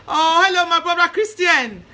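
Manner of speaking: bright, loud, high hello to a friend; excited greeting. Real, not acted. Greeting live viewers by name.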